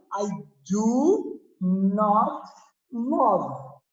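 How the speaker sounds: "do not" drawn out slowly; slow clear negative. Shown as a demonstration. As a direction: spell out "do not" word by word.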